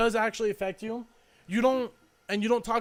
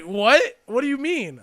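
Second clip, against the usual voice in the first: loud startled disbelief, imitating a friend; retold shock; 5 semitones higher, 8 dB louder, slower than usual. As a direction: imitate the friend's jolted what, much louder. Friend reacts to shocking news.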